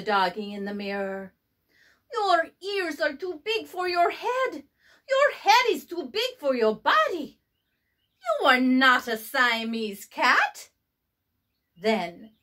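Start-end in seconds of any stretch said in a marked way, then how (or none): 2.1-10.7 higher, lightly voiced cat boy; playful kitten voice. A narrator's reading. Skippy teasing his reflection, high and playful. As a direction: tease the mirror dog in a high voice. Character talks to mirror.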